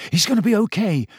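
Harry urging Sirius to flee; urgent Harry. Acted. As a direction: reassure fast, then shove him off. Harry's voiced lines.

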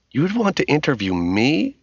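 high, incredulous question; surprised, flattered. A narrator's reading. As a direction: ask in flattered disbelief. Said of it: "She was surprised and flattered".

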